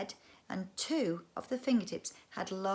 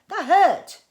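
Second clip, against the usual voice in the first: much higher, louder yell; pained yell; 11 semitones higher, 17 dB louder than usual. Acted. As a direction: yell it, stung and shocked. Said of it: "he yelled".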